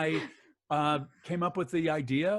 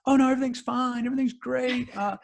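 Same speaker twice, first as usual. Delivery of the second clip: nervous reassurance; 8 semitones higher, 5 dB louder than usual. Retold or imitated. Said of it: "tech looked absolutely terrified".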